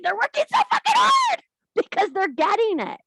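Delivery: cheering her followers on at full volume; excited shout. Real, not acted. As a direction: yell it out with delight. Shouted enthusiasm.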